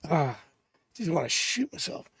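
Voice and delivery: normal talking voice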